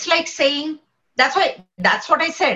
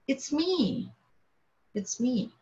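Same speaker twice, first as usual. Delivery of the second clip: hushed, much softer than the talk around it; quiet answer; 10 dB quieter, much slower than usual. Acted. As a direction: say it softly, as a simple self-evident answer. Voicing the answer to "who is?.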